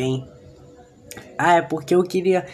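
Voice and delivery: usual speaking voice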